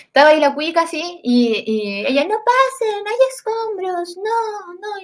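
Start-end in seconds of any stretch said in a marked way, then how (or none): 2.2-5.0 high, slow prim warning; the snooty lady again; mock upper-class lady; posh lady.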